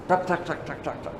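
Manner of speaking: soft, rapid ticking noise; imitated dripping tears. Preacher imitating tears after the dam broke, soft ticking. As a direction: tick quietly like falling drops.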